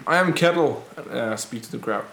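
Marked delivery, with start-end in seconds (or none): 0.1-0.8 raised, higher public-address voice; rallying proclamation. Acted. Rebel leader introduces himself to scattering townspeople, rallying proclamation. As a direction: announce yourself loudly to a crowd. Addressing crowd from wooden box.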